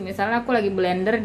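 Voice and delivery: ordinary talk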